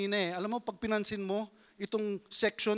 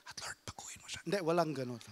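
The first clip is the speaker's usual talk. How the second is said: whispered sneaky aside; 5 semitones lower, 5 dB quieter, much faster than usual. Acted. Spouse sneaking a whispered prayer, then whispered correction. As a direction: slip it in under your breath.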